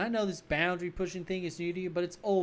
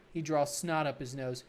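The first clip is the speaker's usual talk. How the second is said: usual speaking voice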